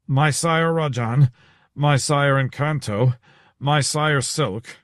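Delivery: slow, gasping out each name; portly man reels off the guest's names, slow and out of breath; panting for breath. Acted. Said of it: "panting after two flights of stairs".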